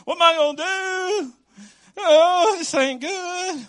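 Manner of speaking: mock despair. Pastor acting out someone who just lost a job, mock crying. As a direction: play the sorry jobless guy, a touch overdone. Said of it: "It's heartbreaking".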